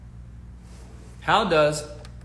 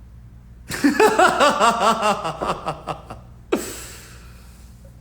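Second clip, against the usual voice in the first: laughing; 5 semitones higher than usual. Real, not acted. Laughing at joke.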